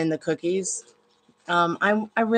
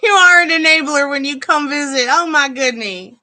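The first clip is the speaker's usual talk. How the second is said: mock scold; 7 semitones higher, 10 dB louder than usual. Real, not acted.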